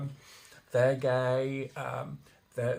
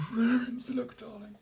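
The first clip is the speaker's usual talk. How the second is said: mock-grand high voice ending on a hushed darling; camp quip; 8 semitones higher than usual. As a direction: toss it off like a glamorous diva.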